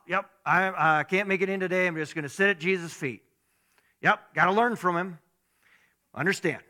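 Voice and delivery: mock phone call.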